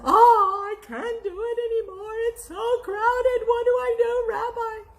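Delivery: high, loud whining plea; broken wail. Acted. Man crying out to the rabbi, high whining despair. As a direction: wail it like a man at his wits' end.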